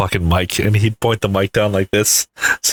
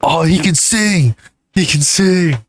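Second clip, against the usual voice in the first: imitating movie character; 8 semitones higher than usual. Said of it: "Superbad scene quote".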